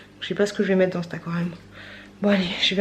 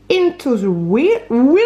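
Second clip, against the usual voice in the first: English accent; 3 semitones higher, 4 dB louder, slower than usual. Real, not acted. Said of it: "mon accent est pourri".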